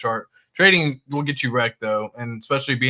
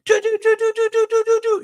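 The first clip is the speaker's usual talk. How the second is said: do do do alarm noise; 5 dB louder than usual. Retold or imitated. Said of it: "You're just, like, freaking out".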